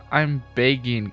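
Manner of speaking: ordinary delivery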